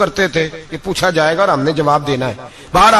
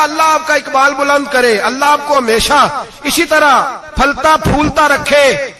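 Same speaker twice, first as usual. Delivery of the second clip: effusive blessing; 7 semitones higher than usual. Retold or imitated. Widow blessing the caliph for her daughters' stipends, effusive. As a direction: pour out a grateful prayer.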